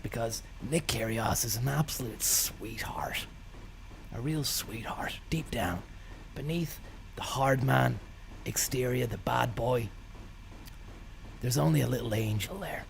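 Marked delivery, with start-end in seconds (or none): 0.0-7.6 whisper. Real, not acted. Fond aside about Kyrgios.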